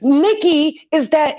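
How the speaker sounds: normal talking voice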